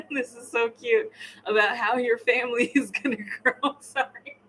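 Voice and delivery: talking through giggles; laughing. Real, not acted. Tarot reader amused by the couple's energy, laughing. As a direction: let laughter break into the words. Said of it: "I'm laughing at, like, the, the energy".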